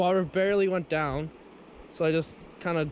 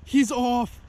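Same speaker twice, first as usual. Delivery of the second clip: dismay; 10 semitones higher, 4 dB louder, much slower than usual. Real, not acted. Fish lost, sudden dismay.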